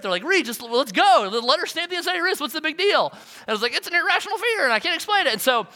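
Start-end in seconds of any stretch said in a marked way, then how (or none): 0.2-3.1 high, impatient mock whining; irate kids. Youth group kids urging their intern through the turnstile, mock irate. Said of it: "the kids are getting irate". 3.7-5.3 high-pitched flustered protest; exasperated self-defense. Retold or imitated. Intern answering impatient kids about his wrist fear, exasperated.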